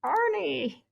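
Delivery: high, cooing call to a puppy; baby voice. Shown as a demonstration. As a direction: coo the name high and adoringly. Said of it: "I was definitely going".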